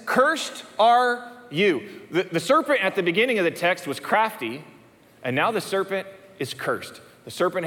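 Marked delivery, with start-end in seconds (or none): 0.1-1.8 slow pronouncement. Acted. God cursing the serpent.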